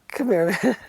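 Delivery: mean it, no joking now; very serious. Retold or imitated. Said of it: "Very serious".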